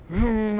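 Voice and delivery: voiced car vroom; imitated engine rev. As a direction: mimic an engine catching.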